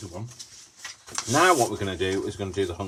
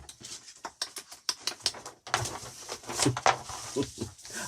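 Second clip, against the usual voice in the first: kangaroo clicking noises, wordless; Skippy; 6 semitones higher, 4 dB quieter than usual. Shown as a demonstration. Showing off a kangaroo impression, wordless clicking. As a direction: make quick tutting kangaroo clicks. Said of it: "I could if I'm Skippy".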